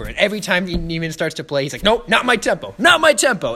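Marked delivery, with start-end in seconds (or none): 1.8-2.7 high, loud imitated correction; first step of a mock escalation; mounting frustration. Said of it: "he just, like, raises intensity". 2.8-3.5 very high, loud mock yell; exasperated conductor's outburst, play-acted; raised intensity. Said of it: "he just, like, raises intensity".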